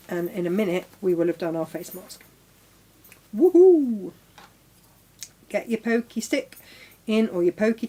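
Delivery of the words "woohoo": high, loud whoop of delight; cheer. Real, not acted. Crafter cheering progress with her co-host, high loud whoop. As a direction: let out a gleeful woohoo. Celebrating finished steps.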